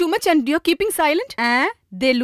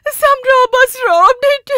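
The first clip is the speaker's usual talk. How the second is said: excited retelling; 9 semitones higher, 5 dB louder, slower than usual. Acted. Excited, high retelling of robbery.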